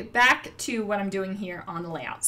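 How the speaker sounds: ordinary delivery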